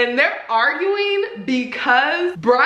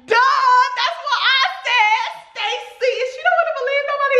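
high, fast told-you-so; high, rapid I-told-you-so; exasperated. Retold or imitated. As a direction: squeal it in disbelief.

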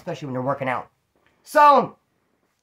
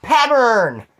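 loud, higher burst as the word comes back; eureka; 6 semitones higher, 8 dB louder than usual. Real, not acted. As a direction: blurt it out the moment you remember. Finally finds the lost word.

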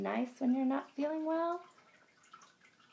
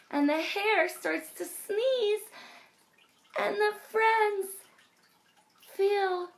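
higher and louder; dramatic twist; 9 semitones higher, 5 dB louder, slower than usual. A narrator's reading. Reading the surprise ending to kids, higher and louder. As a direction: build to a playful uh-oh.

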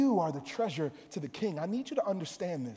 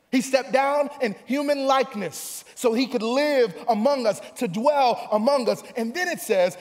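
raised, emphatic sermon cadence; full-force preaching; 6 semitones higher, 7 dB louder than usual. Real, not acted. Preacher expounding the hidden treasure parable to the church, full-force preaching. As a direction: press each phrase home with rising energy.

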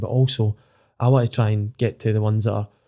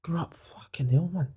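hushed awe; 6 semitones higher, 9 dB quieter than usual. Retold or imitated. Awed recalling dressing room chats.

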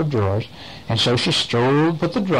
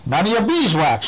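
snapping; 6 semitones higher than usual. Acted. Miss Moody snapping at an unseen stranger, curt and sharp. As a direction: snap it back curtly. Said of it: "she snapped".

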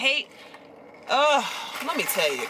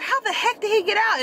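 much higher, exasperated question; alarmed disbelief; 13 semitones higher than usual. Retold or imitated. Owner demanding how her dog escaped, high exasperated voice. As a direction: ask it in high-pitched dismay.

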